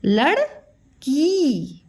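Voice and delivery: लड़की drawn out slowly, long ई at the end; slow syllable demo. Shown as a demonstration. Teacher to young pupils, sounding out लड़की slowly.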